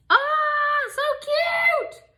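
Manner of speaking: very high shout of delight; drawn-out high squeal. Real, not acted. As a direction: squeal at the last bow. Last bow.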